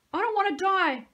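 high, loud sobbing plea; terrified crying plea. A narrator's reading. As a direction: wail it through tears. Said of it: "Jimmy sobbed".

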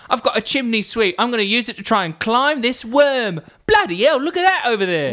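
high, hammed-up chimney-sweep Cockney. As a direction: play a cheery mock Cockney sweep.

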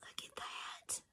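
breathless whispered look; awed whisper. Real, not acted. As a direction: hush it in awe. Awed aside.

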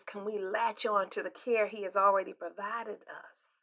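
unvoiced, soft question; whispered. Real, not acted. Pastor posing the real question, whispered. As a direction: breathe the question gently.

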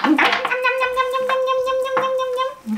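high sing-song munching; playful nom nom. Pretend. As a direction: pretend to gobble it up. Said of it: "playful eating noises to child".

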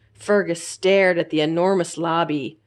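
ordinary delivery, nothing marked

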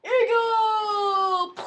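high loud Eagle cry; loud shout. Acted. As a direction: cry it out at the top. Calls the trick again.

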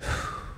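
soft, unvoiced sigh of dread; mother sighing before bad news; heavy sigh. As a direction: let out a long sigh. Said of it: "she just went".

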